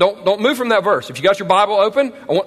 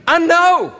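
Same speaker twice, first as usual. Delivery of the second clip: God's firm declaration; 7 semitones higher than usual. Retold or imitated. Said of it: "God tells Satan".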